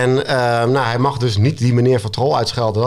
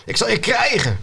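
high-pitched threat, no real volume; mock roar; 8 semitones higher than usual. A narrator's reading. Narrator voices the troll threatening the boys, mock roar. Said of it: "brulde hij".

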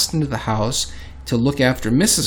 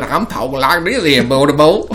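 exaggerated Southern US accent, louder; 3 semitones higher, 4 dB louder than usual. Shown as a demonstration. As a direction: slip into a mock Southern twang. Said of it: "if I go down south".

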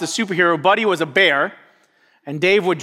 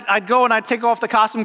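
ordinary talk, nothing marked

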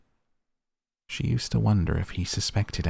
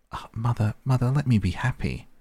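pleading; 6 semitones higher than usual. Acted. Sybil pleading with her mother.

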